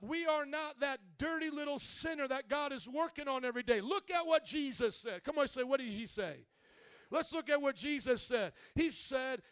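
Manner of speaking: ordinary talk, nothing marked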